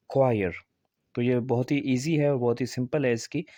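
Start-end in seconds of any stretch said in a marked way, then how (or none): 0.1-0.5 "क्वायर" starting with a k sound; correct pronunciation. Shown as a demonstration. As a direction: say "क्वायर" as kwa-yer. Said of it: "बल्कि". ("क्वायर", क से सही उच्चारण)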